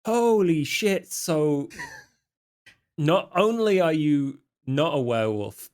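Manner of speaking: normal talking voice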